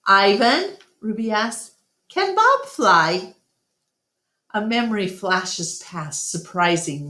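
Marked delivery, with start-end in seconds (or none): 0.1-3.3 put-on high, eager little elephant girl; young Ruby voice. Narrator voices little Ruby asking Ivan. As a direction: ask like a curious child.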